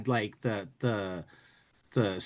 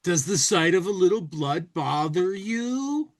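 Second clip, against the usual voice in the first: sarcastic; 6 semitones higher than usual. Acted.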